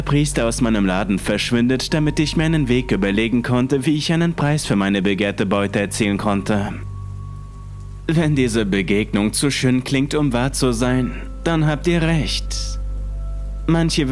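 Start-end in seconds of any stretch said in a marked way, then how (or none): none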